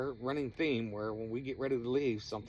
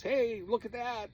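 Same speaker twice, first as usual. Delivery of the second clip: high, loud, drawn-out mock yell; mock shout; 12 semitones higher, 4 dB louder than usual. Narrator mocking friend's vague warning, high loud drawn-out mock shout. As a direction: ham up the friend's useless alarm. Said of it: "not just".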